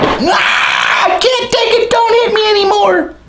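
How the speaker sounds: very high, squealing plea for mercy; hammed-up falsetto panic; mock terrified wail. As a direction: squeal like a beaten fighter giving up. Acts out a beaten fighter pleading.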